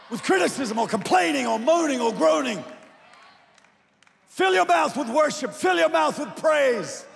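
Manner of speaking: rally the congregation, full voice; preaching at full force. Acted.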